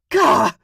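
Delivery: a loud, high, raging cry of fury; frustrated shout. A narrator's reading. Dragon daughter alone in her bedroom, furious yell. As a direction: let out a sharp burst of rage. Enraged after reading his words.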